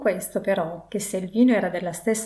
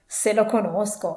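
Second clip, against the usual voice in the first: plain reading voice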